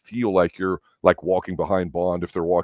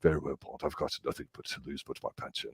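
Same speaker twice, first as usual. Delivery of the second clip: much softer, resigned mock Saunders; imitating Saunders; 4 semitones lower, 14 dB quieter than usual. Quoting Saunders to Bond, softer and resigned. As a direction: drop the voice, lightly doing a weary Saunders. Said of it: "He's like".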